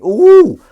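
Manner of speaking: very high, loud whooping ooh; shrieky mock-impressed coo. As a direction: let out a big hammed-up ooh. Reacts to fan's news while reading.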